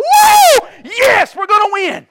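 high, loud whooping cheer; victory shout. Retold or imitated. Fan shouts with joy at the caution flag. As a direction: yell like your driver just got the break. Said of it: "And I'm like".

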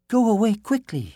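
high, urgent princess voice; princess crying out. A narrator's reading. Princess warns the prince to flee, crying out high. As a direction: cry out a warning, high and loud. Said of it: "she cried".

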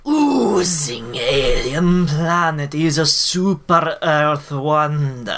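slow, drawn-out put-on alien voice. Reading a space headline to cohost in a slow alien voice. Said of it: "You're not supposed to talk like them now".

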